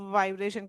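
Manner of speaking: वाइब्रेशन opened on a held 'vvv'; vibrating V. Shown as a demonstration. Teacher asking viewers to try it, buzzed वाइब्रेशन. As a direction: buzz the first sound of वाइब्रेशन. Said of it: "कह के देखें".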